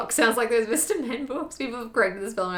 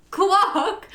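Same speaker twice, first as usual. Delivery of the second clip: Clock squeaked high through laughter; laughing disbelief; 10 semitones higher, 6 dB louder than usual. Real, not acted. Viewer recalls a misspelled surname, laughing. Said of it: "best misspelling".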